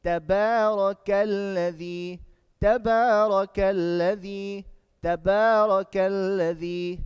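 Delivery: slow, joined-up chant with long vowels; measured, resonant tajweed drill; Quran recitation. Shown as a demonstration. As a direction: recite the two words as one sustained phrase. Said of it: "add the two words".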